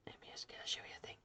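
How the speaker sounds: very fast, hushed whisper; whispered aside. Real, not acted. Streamer slips viewers a preview, quick whispered aside. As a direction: rush it out under your breath. Rushed aside before a break.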